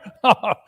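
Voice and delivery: high gleeful cackle; wicked cackle. Acted. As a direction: cackle over the loot. Thieves gloating together.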